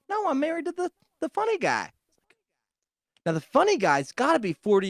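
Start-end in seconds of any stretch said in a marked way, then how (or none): 0.1-1.9 high-pitched mock old-woman voice; correcting old lady. Old lady explaining whom she married, high correcting voice.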